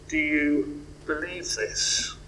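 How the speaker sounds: soft, slow question. Shown as a demonstration. Preacher softly asks the congregation: do you believe this. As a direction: let the question sink in quietly. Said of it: "there's the question, I guess, for us all".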